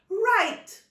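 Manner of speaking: high, loud, bright call; aunt taking charge. Acted. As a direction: grab everyone's attention.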